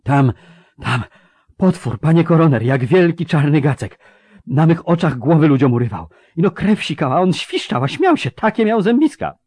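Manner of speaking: high, loud, hysterical soldier; trembling terror. Acted. Soldier pale with dust describing a monster, trembling terror. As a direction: stammer in at first, then climb into shrill panic. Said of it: "latały mu ręce i trząsł się głos".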